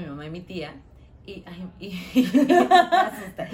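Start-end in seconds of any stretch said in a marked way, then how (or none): 2.2-3.5 laughing through the words; can't stop laughing. Real, not acted. Laughing while telling story. (riéndose)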